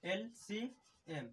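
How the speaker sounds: LCM stretched out very slowly and quietly; teacher spelling LCM slowly and softly; slow dictation. Shown as a demonstration. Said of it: "LCM का क्या होगा full form?".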